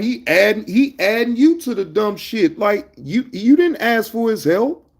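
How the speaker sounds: high-pitched, incredulous scolding; exasperated challenge. Real, not acted. Streamer scolds a chatter for unasked help, exasperated.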